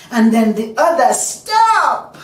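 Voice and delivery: loud, high, forceful storytelling; full-force, high exclamation; climactic outcry. A narrator's reading. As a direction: build to a piercing cry on the last word. Third lash, building to climax.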